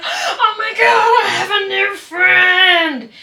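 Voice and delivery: high, loud, slow, laughing shout; overjoyed squeal. Retold or imitated. Greeting a new friend like a puppy, shouting with a laugh. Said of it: "instead of me just being like".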